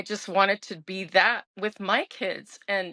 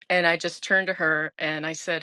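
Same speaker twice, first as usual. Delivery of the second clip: ordinary talk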